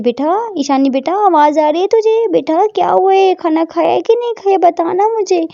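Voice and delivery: mother coaxing an answer out of her daughter; insistent mother. Acted.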